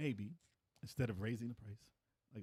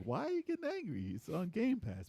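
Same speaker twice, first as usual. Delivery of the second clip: incredulous retort; 8 semitones higher than usual. Retold or imitated. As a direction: question the angry crowd in a raised pitch. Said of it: "And I was like".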